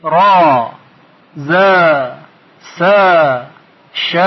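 each letter held with long aa: raa, zaa, saa; long aa syllables. Shown as a demonstration. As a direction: stretch "ش" to shaaa, pausing after each. (تلفظ حروف با آی کشیده)

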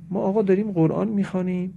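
usual speaking voice

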